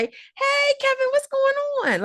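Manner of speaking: high, bright hallway hello; cheerful greeting. Retold or imitated. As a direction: lift the kid up with a cheery hi. Colleagues greeting child cheerfully.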